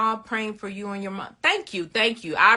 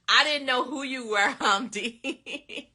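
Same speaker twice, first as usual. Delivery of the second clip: laughing; 4 semitones higher, 4 dB quieter than usual. Real, not acted. Repeats through giggles.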